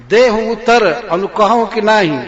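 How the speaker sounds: high, loud demand for an answer; demanding queen. Acted. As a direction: press the king for a reply. Repeated demand. (जवाब माँगती कैकेयी)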